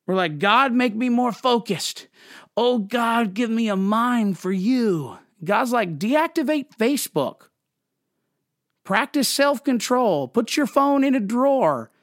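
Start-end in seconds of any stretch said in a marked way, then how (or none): 0.4-5.2 higher, slow, hammed-up plea; mock pleading prayer. Pastor mocking believers praying for focus, higher mock plea. 6.0-11.9 much higher, lightly voiced God; God's dry retort. God answering a prayer for focus, much higher mock voice.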